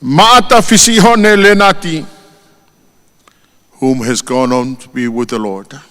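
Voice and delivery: normal talking voice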